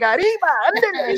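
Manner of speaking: high, bright Spanish cry of arriba ándale; mock Mexican cheer. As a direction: call it out like a cheering Mexican woman. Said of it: "Mexican woman be like".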